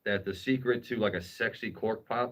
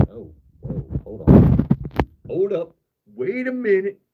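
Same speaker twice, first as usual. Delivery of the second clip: surprised; 11 semitones higher, 11 dB louder, slower than usual. Real, not acted. Sudden surprise at chat messages.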